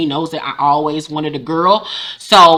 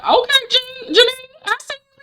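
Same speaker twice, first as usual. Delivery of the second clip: very high falsetto cheer; tiny high-pitched voice egging her on; mock-impressed squeal. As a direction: squeak it out in playful approval. Cheering on Janine in recap.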